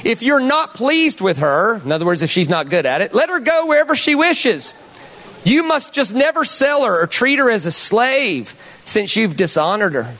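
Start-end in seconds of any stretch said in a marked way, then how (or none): 0.0-1.8 slow, higher Southern drawl; Southern accent. Acted. Pastor reading Deuteronomy to the room, slow Southern accent. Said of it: "in a Southern accent". 3.1-10.1 high, swooping Southern scripture reading; Southern accent. Acted. Pastor reading Deuteronomy to the room, high Southern accent. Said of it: "in a Southern accent".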